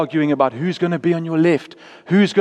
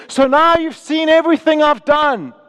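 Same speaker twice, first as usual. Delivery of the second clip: high, raised declaration; emphatic address; 8 semitones higher than usual. Acted. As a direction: proclaim it to the watching crowd. Acted speech of Jesus to watchers.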